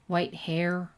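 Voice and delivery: ordinary delivery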